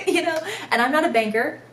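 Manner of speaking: words spilling through a real laugh. Real, not acted. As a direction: laugh it off while talking. Laughing at her own rant.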